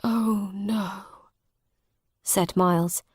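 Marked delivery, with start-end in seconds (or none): 0.0-1.0 a soft, crying oh no; soft grief. A narrator's reading. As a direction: let the loss break through softly. Miles learns father died.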